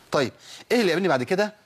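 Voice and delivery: usual speaking voice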